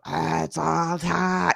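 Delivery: very slow, eerie drawn-out line; scary voice. Retold or imitated. Host mocking the expected horror voice, slow eerie. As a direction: stretch it like a cheesy horror ghost. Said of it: "you want the voice to go".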